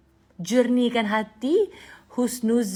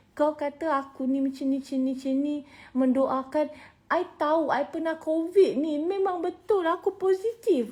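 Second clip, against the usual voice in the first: a higher, pleading protest; heated retort; 7 semitones higher than usual. Retold or imitated. Wife arguing with husband that she really is positive. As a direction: argue it back, half laughing. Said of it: "I cakap macam tu".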